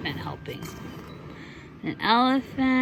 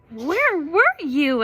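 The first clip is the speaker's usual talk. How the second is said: slow, raised questioning voice; Susie asking; 7 semitones higher, 5 dB louder, slower than usual. Acted. Mother reading, Susie questions late Jack.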